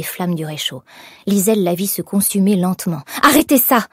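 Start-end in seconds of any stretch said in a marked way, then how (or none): 3.2-3.9 loud, high, sharp order; tense with anger. A narrator's reading. Young woman seeing her sketch burn, tense angry order. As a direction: cry it crisply, taut with anger. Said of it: "d'une voix nette, tendue par la colère".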